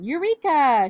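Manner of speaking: loud, high, stretched-out cry; triumphant shout. A narrator's reading. Doctor Dinglebat bursting into the gym, triumphant shout. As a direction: shout it like a great discovery. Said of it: "shouted Doctor Dinglebat".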